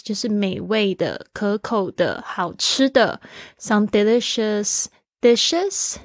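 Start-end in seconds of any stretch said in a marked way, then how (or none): none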